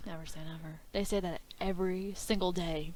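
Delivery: soft, low near-whisper; hushed agreement. Real, not acted. Guest echoing the host's wrestling saying, hushed. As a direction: say it low, almost to yourself. Echoes saying under the breath.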